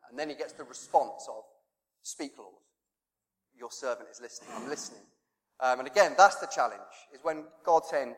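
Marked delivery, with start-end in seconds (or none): 2.0-5.0 soft, lower, humble reply; hushed answer. Retold or imitated. Young Samuel replying to God's call, softly and humbly. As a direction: answer quietly, listening.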